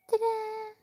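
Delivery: high, showy reveal; ta-da flourish. Real, not acted. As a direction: announce it with a flourish. Presenting with flourish.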